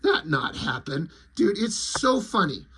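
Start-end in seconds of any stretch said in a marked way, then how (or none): 0.0-1.1 low quiet alien denial; deadpan alien. Retold or imitated. Lizard rejecting Popo's tale, low deadpan. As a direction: lizard dismissing him, low and blunt. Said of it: "And he's like".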